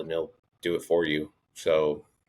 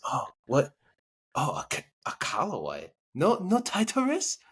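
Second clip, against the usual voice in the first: puzzled Japanese-accented imitation, rising high at the end; mock Japanese accent; 9 semitones higher than usual. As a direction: question it like a surprised Japanese golfer. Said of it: "Japanese executives".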